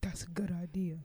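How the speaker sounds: whispered agreement. Acted.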